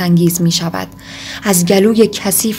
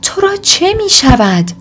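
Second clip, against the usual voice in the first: a much higher startled cry of concern; alarmed cry; 9 semitones higher than usual. A narrator's reading. Alyosha, alarmed, cries out to his brother: startled high cry. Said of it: "و فریاد زد".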